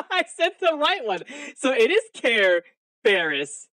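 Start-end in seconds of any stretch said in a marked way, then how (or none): 0.1-1.2 higher voice breaking into laughter; gleeful laughing realization. Real, not acted. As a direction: say it through giggles. Prankster cracking up after the call.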